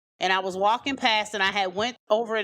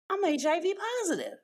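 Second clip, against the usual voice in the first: stunned confession; 10 semitones higher than usual. Retold or imitated. Tells uncle the news.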